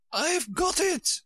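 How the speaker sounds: high, drawn-out cry of triumph; jubilant, high-pitched Queen; triumphant cry. A narrator's reading. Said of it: "she cried in a triumphant tone".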